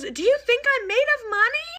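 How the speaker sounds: high, strained mock-tearful mom; imitating an exasperated parent near tears; about to cry. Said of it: "Like, about to cry".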